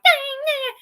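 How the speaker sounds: high, loud mock-angry whine; mock mad. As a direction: imitate yourself getting worked up. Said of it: "except if I get mad".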